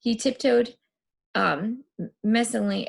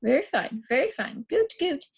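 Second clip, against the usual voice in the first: high squawking goot goot; hopping bird-boy chant; crazed bird cry; 10 semitones higher than usual. Acted.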